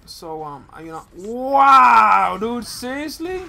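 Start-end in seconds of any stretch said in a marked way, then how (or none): 1.2-3.4 shocked outburst. Real, not acted. Sudden game event.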